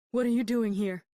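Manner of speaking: startled, fearful challenge; frightened demand. A narrator's reading. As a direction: demand it in alarm. Frightened sick woman confronts strangers.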